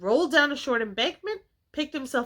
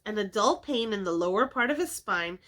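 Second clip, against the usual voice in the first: usual speaking voice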